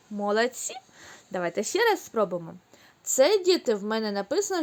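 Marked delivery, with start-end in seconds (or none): none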